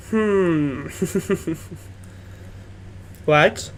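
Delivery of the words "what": ordinary delivery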